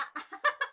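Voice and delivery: a high, bright burst of laughter. Real, not acted. Seller laughing at her own joke to viewers, high laugh. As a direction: laugh off the mock threat.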